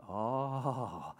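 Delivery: ordinary talk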